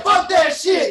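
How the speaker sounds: high and loud; shouted lyric. Retold or imitated. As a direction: yell it like [name]. Quoting [name] lyric.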